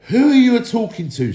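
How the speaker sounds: very high, loud outraged retort; furious troll. A narrator's reading. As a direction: bristle with outrage. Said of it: "said William".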